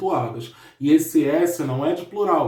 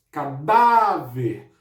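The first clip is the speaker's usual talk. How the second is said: cadáver drawn out slowly, stress on dá; slow demo word; 3 semitones lower, much slower than usual. Shown as a demonstration. Teacher repeats the word slowly to stress dá, careful. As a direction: model cadáver slowly for the class. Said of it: "Por que que o dá é acentuado?".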